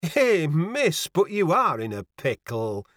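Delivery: lightly voiced Yorkshire landlady; a touch of dismay in northern dialect; mildly exclaiming country woman. A narrator's reading. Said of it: "cried the landlady".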